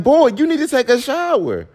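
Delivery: exasperated yell. Retold or imitated. Girlfriend ordering boyfriend into the shower, loud exasperated yell. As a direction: scold him like a fed-up mom. Scolding boyfriend who refuses bathing.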